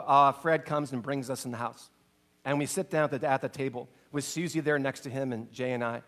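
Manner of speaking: ordinary talk, nothing marked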